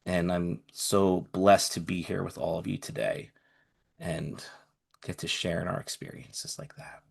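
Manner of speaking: fighting tears, barely above a breath; choked-up whisper. Real, not acted. Grateful friend thanking the group, near tears. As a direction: voice fading to a teary whisper. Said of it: "trying not to cry the entire time".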